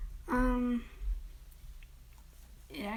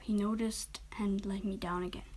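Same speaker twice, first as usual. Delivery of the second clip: usual speaking voice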